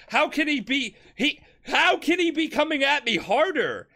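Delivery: can't believe what's happening; shocked disbelief. Retold or imitated. Dio, being beaten, asking himself in panicked disbelief.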